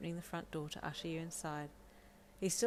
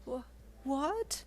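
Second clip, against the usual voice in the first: higher, rising shocked question; a high, stunned "what?"; startled cry; 8 semitones higher than usual. Acted. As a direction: catch him completely off guard. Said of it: "Deku cried".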